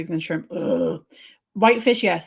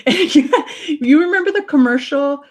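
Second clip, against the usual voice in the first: laughing; 5 semitones higher, 7 dB louder than usual. Real, not acted. Laughing while recalling an ad.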